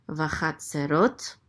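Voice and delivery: "Vachatzerot" slowly, rising onto a long ROT; stress on last syllable. Shown as a demonstration. Teacher reading out the place name, stress on ROT of "Vachatzerot". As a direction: lean on the ROT ending of "Vachatzerot". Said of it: "accent on the last syllable".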